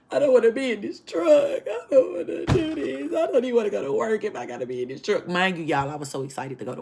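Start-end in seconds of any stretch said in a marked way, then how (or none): 0.1-5.3 mock crying tantrum. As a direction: whine like a spoiled kid crying. Re-enacts her crying tantrum.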